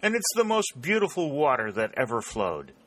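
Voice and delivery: high-pitched delight, easing down toward the end; excited exclamation. A narrator's reading. Warner running toward the brook with Dick, exclaiming. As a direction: exclaim with thirsty rapture. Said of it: "exclaimed Warner".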